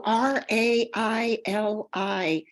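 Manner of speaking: usual speaking voice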